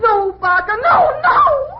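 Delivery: rising, frantic screams; terrified pleading scream. Acted. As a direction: beg him to stop in terror. Begging him not to kill.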